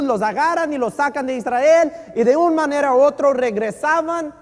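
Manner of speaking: loud, high, driving pulpit delivery; preaching at full force. Acted. Preacher to congregation about exile, loud and high. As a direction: keep the fire going through the history.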